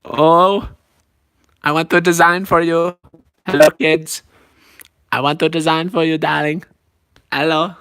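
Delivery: put-on theatrical designer with an accent; put-on character voice, emphatic; Edna Mode. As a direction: offer it grandly as a diva designer.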